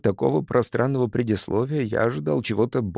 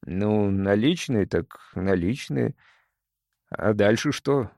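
drawn out, puzzled; slow, not getting it; slower than usual. Acted. Jake puzzled about cash.

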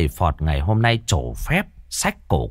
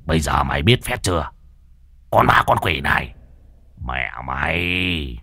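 gloating master; 7 semitones lower than usual. Acted. Master gloats over the ghost.

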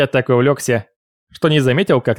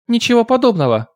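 arguing; 11 semitones higher than usual. Acted. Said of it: "заспорила Люсиль".

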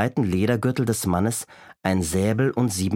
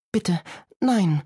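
pleading; 9 semitones higher than usual. A narrator's reading. Grandmother pleads at gunpoint.

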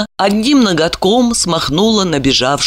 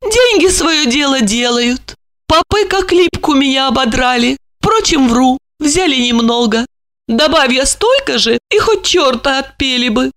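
a widow griping about greedy priests; high female voice; resigned lady; 7 semitones higher than usual. A narrator's reading. Olga voiced by narrator.

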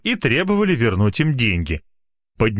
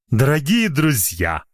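высокий торжественный голос мага; lofty solemn speaker; pompous magician; 5 semitones higher, slower than usual. Acted. Magician's speech voice. (голос напыщенного мага)

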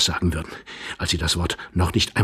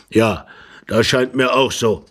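put-on louder, measured old husband's voice; deliberate old man; 2 semitones higher, 5 dB louder than usual. A narrator's reading. Old husband agrees with his wife, deliberately. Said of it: "meinte der Greis bedächtig".

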